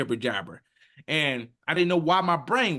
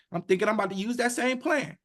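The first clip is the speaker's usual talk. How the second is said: ordinary talk, nothing marked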